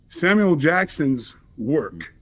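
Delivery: usual speaking voice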